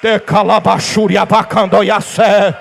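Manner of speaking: slow, drawn-out tongues utterance; speaking in tongues. Real, not acted. Preacher breaking into tongues before the church, slow ecstatic utterance. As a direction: pour out a slow stream of tongues.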